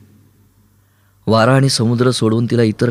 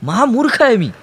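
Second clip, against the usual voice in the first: much higher, screamed self-reproach; shrieking at himself; 12 semitones higher than usual. A narrator's reading. Boy shrieks at himself for losing the oar. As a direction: scream it in disgust at yourself. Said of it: "तो किंचाळला".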